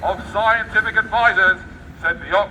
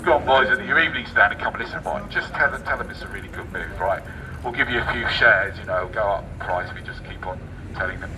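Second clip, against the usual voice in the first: conspiratorial aside; 11 semitones lower, 3 dB quieter than usual. Pretend. Imagined insiders plotting quietly.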